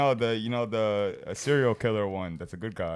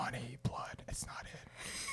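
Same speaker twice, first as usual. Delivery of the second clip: slow unvoiced whisper; 2 semitones higher, 18 dB quieter, slower than usual. Real, not acted. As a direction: draw out a hushed whisper. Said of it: "we just whispered there".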